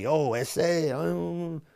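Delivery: a hint of a Chicano accent, mocking; West Coast Chicano slang. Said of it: "everything's like".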